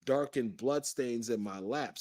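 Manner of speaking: ordinary delivery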